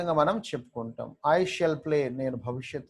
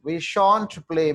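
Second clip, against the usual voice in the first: slow, spelled-out; 3 semitones higher, 8 dB louder, much slower than usual. Shown as a demonstration. Teacher models negative form.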